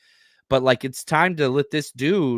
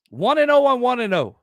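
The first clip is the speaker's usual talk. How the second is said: excited; 9 semitones higher, 4 dB louder than usual. Real, not acted. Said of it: "hyped matchup".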